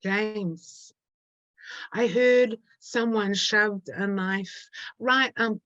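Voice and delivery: slow, halting poem performance with long pauses; poem reading. Acted. Poet taunting James Cook in her poem, slow performed verse. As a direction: address it directly, phrase by phrase, letting each hang.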